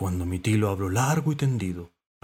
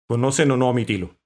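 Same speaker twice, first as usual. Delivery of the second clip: loud, mildly irked question; annoyed; 3 semitones higher, 7 dB louder than usual. A narrator's reading. Cormorant questioning the visitors, annoyed. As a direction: demand an answer, a touch annoyed.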